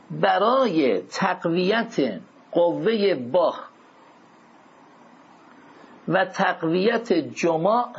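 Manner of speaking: measured phrases with long pauses to write; slow dictation. Shown as a demonstration. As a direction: dictate slowly for note-takers. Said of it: "یادداشت کنید".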